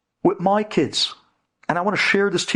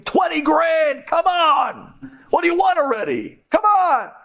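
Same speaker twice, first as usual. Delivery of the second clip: desperate begging; 13 semitones higher than usual. Retold or imitated. Two guilty men begging the victim for forgiveness, shouting. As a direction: beg hard, almost shouting. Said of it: "They were begging him".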